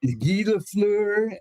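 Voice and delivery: Guy Lafleur drawn out slowly, sung high and French-style; French pronunciation. Retold or imitated. Fan savouring a hockey hero's name, drawn-out French.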